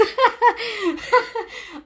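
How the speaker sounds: high falling laugh. Real, not acted. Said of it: "I'm glad you got a laugh out of that".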